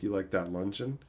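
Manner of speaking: teasing low voice. Retold or imitated. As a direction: tease her in a low sultry voice. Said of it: "I'm like".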